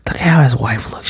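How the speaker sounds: hushed aside to the viewers; whisper. Real, not acted. Whispering to viewers about the wife. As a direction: breathe it like a secret.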